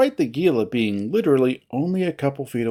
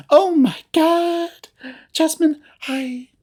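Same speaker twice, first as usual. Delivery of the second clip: very high, drawn-out squeal; gushing excitement; 14 semitones higher, 4 dB louder, slower than usual. Acted. Feline rushes over to hug acquaintance, squealing. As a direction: squeal slowly and high with delight.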